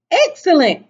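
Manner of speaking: high, loud burst of delight; bright-eyed storybook exclamation; excited cry. A narrator's reading. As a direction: exclaim it like an eager youngster. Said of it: "cried Sammy".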